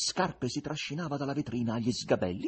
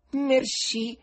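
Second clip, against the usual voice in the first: much higher, haughty thanks; haughty reply; 13 semitones higher, 6 dB louder than usual. Acted. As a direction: toss it off proudly. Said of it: "rispose altera la donna".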